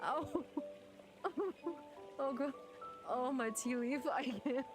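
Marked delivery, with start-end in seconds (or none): none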